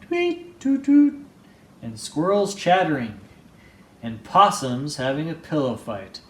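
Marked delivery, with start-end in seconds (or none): 0.0-1.2 high tweeting bird calls; bird chirps. Acted. Birds waking Bruce in the morning, high chirping. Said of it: "birds chirping".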